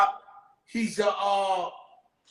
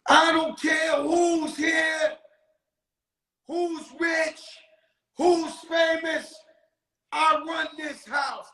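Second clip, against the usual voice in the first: mock John Wayne guard yelling at the line; John Wayne swagger; 6 semitones higher, slower than usual. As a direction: bark orders like a cowboy sheriff. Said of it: "She comes out there like John Wayne".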